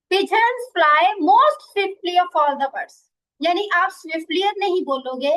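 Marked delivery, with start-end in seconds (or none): 0.1-2.9 very slow, louder example sentence; slow dictation. Shown as a demonstration. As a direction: dictate it clearly to the class. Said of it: "teacher reads example slowly". (धीमी, ज़ोरदार लिखवाने वाली आवाज़)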